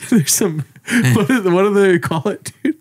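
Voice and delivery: high-pitched, laughing as he talks; cracking up. Real, not acted. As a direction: struggle to talk through real laughter. Cracking up over the slang.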